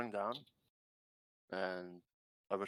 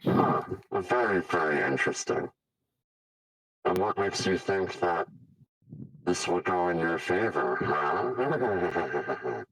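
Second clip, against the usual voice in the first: loud, taunting villain voice; hissing reptile growl; 11 dB louder, slower than usual. Acted. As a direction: taunt with menace.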